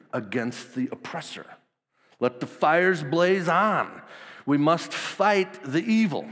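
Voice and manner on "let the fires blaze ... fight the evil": slow, loud rallying cry; a preacher's mock battle call, slow and higher; rallying the troops. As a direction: rouse the crowd like a rebel leader. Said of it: "you can imagine him kind of rallying the troops".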